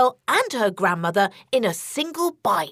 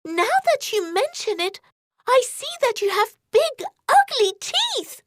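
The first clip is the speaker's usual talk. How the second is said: put-on higher, questioning girlish voice; girl eyeing the bed, higher; suspicious girl; 12 semitones higher than usual. A narrator's reading. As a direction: ask it doubtfully like a wary child.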